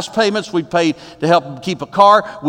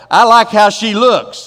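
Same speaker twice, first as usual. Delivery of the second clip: louder, slow admiring line; admiring guy; 4 semitones higher, 7 dB louder, slower than usual. Retold or imitated. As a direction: say it like a smitten young man. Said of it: "That's what he's saying there".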